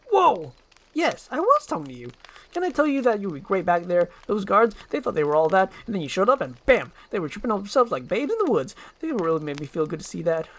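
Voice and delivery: a motor-mouth sidekick gushing praise; fast-talking eager donkey. Acted. Donkey chatters at Shrek.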